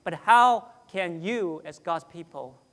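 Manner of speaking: ordinary delivery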